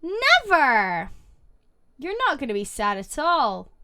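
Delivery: normal talking voice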